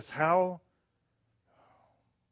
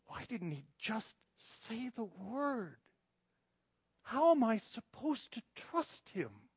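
grieving plea; 2 semitones higher, 5 dB quieter than usual. Retold or imitated. Pastor retells a grieving friend's question, softly pleading. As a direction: relay her broken question with a touch of tears. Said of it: "she's crying".

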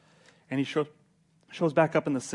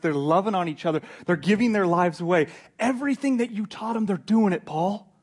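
louder and higher with each clause; building excitement; 4 semitones higher, 5 dB louder than usual. Acted. Timothy encourages Paul about the church, excitedly. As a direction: pile up the good news, building to a peak.

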